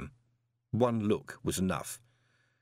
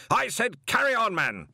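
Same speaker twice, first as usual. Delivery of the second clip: louder, high command; barked order; 12 semitones higher, 4 dB louder than usual. A narrator's reading. Arch-Chancellor orders the wizard to go on, louder. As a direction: bark at him to continue.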